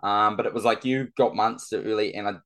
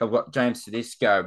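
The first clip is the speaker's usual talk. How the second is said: usual speaking voice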